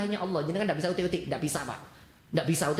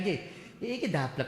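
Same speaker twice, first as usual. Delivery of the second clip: ordinary delivery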